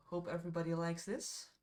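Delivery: normal talking voice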